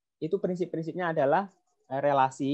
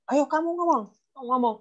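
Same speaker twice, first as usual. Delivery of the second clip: much higher, nagging push to speak; pushy parent; 9 semitones higher than usual. Retold or imitated. Parent forcing introverted child to speak, nagging. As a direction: pressure the shy kid to talk. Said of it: "jangan memaksa anak untuk aktif".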